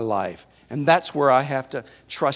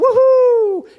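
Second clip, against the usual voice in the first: a high, loud swing-set woohoo; gleeful whoop; 7 dB louder than usual. Retold or imitated. Preacher recalling kids on swings, whooping with glee. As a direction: whoop like a kid flying forward on a swing. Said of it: "you go".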